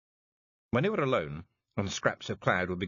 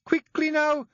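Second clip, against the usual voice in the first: very high, loud call; shouting; 8 dB louder, slower than usual. A narrator's reading. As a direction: hurry them from the hole. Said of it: "Jeanie shouted down the hole".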